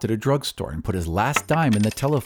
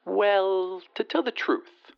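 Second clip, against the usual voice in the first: high-pitched lady on the phone; haughty lady; 9 semitones higher, slower than usual. A narrator's reading. Lady explains herself to her rejected suitor, high haughty voice. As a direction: lift it high into a frank lady's voice. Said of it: "said the lady".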